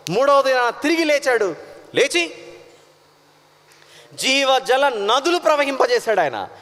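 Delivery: high, fervent sermon voice; a preacher building fervour; preaching. Acted. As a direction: proclaim it to the congregation. Preaching with raised pitch.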